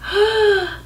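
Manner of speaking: high gasp of surprise; surprised gasp. Shown as a demonstration. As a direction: gasp like a friend just showed up unannounced. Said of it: "Try that".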